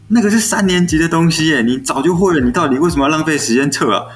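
exasperated teacher. Retold or imitated. Teacher questioning pupil who wants a test, exasperated. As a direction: scold with mock disbelief.

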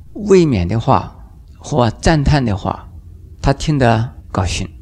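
ordinary talk

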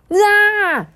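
high, loud shriek of fright; startled scream. Acted. Dudu Xiang startled by the big toad, high frightened shriek. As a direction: yelp as the toad jumps out.

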